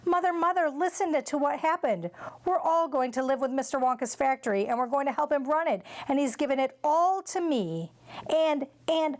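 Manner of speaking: high, tumbling excited news; blurting out the news, stumbling over words; excited child. A narrator's reading. Charlie rushes to tell his mother the news, high and excited.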